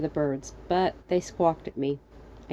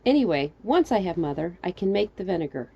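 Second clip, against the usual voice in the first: plain reading voice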